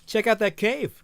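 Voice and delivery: loud, high American voice; American accent. Acted. Coco pointing out a cave to her partner, American accent. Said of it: "people with American accents, I can do this one".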